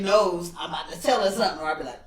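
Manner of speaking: ordinary delivery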